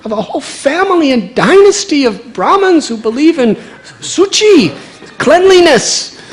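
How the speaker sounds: high, loud scandalised scolding; mother scolds her son for the dirty puppy, high and loud; shocked scolding mother. Acted. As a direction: shriek at the son in horror. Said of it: "Sachi Devi was shocked".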